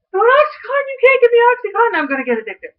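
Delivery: mock panic. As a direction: protest in comic horror at the drug. Panicking over being given Oxycontin.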